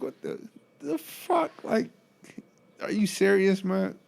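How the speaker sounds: high, incredulous what the fuck; replaying his shock at the patient; stunned disbelief. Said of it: "I'm looking at him like".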